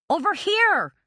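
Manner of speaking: high, loud call; calling out again. A narrator's reading. Carmela calls again to be found, shouting. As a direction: shout it across the trees. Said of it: "she called out again".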